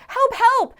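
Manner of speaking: high, loud final cry for help; last desperate call. Acted. Trapped man's final call for help, high loud cry. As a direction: put all the last energy into the shout. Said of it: "with one final sense of energy".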